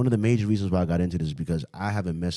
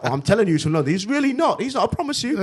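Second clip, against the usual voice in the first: higher-pitched mock insistence; arguing; 12 semitones higher than usual. Retelling teachers' lunch-break dispute, mock arguing. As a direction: imitate two people bickering, pitch climbing. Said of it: "had them arguing".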